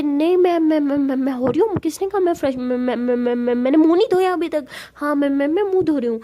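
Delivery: stuck repeating words, stalling in a fluster; flustered stammering. Acted. (घबराकर हकलाते हुए)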